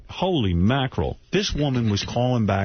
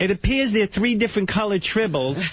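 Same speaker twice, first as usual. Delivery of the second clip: high, nasal-free mock nerd voice, raised pitch; geeky fan; 8 semitones higher than usual. Host mocking a Trekkie fan, high eager nerd voice.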